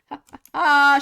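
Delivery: loud mocking ha ha ha; gloating laugh. Real, not acted. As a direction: crow over her like you won. Gloating over rival's suspension.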